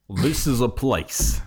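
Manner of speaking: put-on slow Russian-accented sports call; teasing imitation of an accented announcer; mock Russian commentator. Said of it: "halfway between like Russian and a sports commentator".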